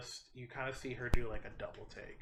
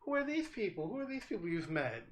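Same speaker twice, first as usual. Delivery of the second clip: high, bright surprise at strangers; curious surprise; 10 semitones higher than usual. Acted. Mother spotting her child's new friends, surprised and high. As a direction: ask with surprised curiosity, pitched up. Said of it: "looks at everybody else and goes".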